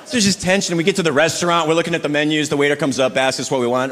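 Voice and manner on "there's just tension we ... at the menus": ordinary talk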